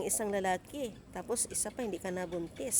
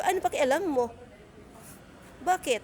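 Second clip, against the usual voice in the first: loud, higher, defiant snap; angry retort; 7 semitones higher, 5 dB louder than usual. Retold or imitated. Answering the gossiping coworker, loud and defiant. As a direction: throw the question back hard.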